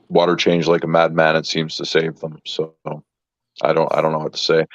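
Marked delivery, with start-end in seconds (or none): none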